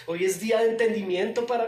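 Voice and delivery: loud pulpit proclamation; fiery preaching. Acted. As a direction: lift the congregation up. Preaching at full force.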